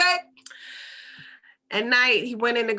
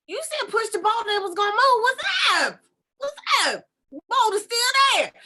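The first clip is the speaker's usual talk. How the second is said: high-pitched, loud, incredulous protest; exasperated acted outburst; indignant complaint; 11 semitones higher, 4 dB louder than usual. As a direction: shout it up at God in mock outrage. Paul complains indignantly to God.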